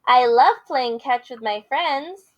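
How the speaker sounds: lively picture-book read-aloud with character voices; reading to children. Acted. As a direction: read it big for the kids.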